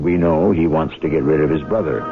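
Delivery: ordinary talk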